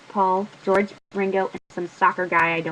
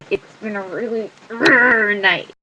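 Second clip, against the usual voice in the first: plain reading voice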